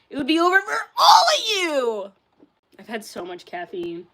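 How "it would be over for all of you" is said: mock shout. Hyped boast about kickflips.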